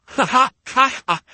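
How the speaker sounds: high, sarcastic ha ha ha; mocking laugh. Real, not acted. Mocking the critic's claim.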